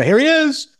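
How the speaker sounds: high, showy introduction; retold on-air reveal, high-pitched; mock presentation. Radio hosts presenting the guest.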